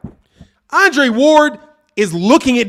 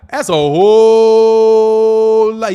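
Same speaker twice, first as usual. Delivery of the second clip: drawn out; much slower than usual. Acted. Drawn-out emphasis on four knockdowns.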